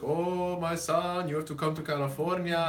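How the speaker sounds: hammed-up old Greek man calling; Greek accent. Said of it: "in his very Greek accent".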